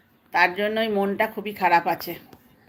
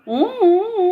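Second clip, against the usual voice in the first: high imitated baby coos; baby coo imitation; 10 semitones higher, 5 dB louder than usual. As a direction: mimic the baby's hooting coos. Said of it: "উ করছে".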